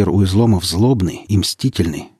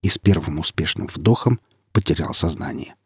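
usual speaking voice

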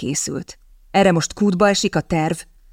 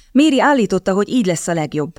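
usual speaking voice

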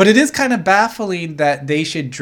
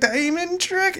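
high, softer confused voice; puzzled question; 13 semitones higher, 5 dB quieter, slower than usual. Acted. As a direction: play a baffled kid mishearing. Acting the puzzled boy's reply.

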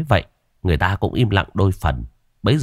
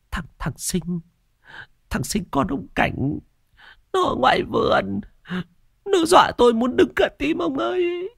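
high, thin, stammering wail; frightened wife. Acted. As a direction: plead fearfully to your husband, rising in pitch. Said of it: "giọng đầy sợ sệt".

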